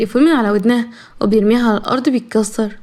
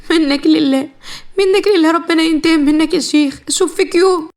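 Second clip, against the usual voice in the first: cursing cry; 5 semitones higher than usual. Acted. Trapped young wife cursing her husband, rising to a cry. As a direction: throw the curse at him with all your pain.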